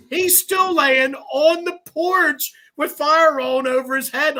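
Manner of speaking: slow, high, loud amazement; building disbelief; incredulous. Real, not acted. Telling the crew about the man on the burning porch, incredulous.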